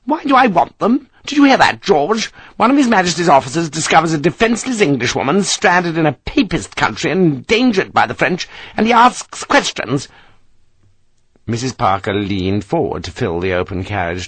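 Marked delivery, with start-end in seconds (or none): 0.0-10.1 indignant matron, high and fast; outraged. Acted. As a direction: appeal to George in disbelief. Mrs Parker outraged to husband.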